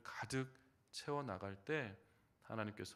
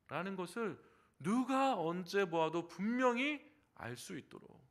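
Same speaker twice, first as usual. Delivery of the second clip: ordinary talk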